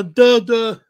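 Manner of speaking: dumb duh. Retold or imitated. Mocking a dumb actor.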